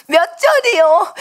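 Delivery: excited. Retold or imitated. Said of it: "무서워서 발발 떨면서도".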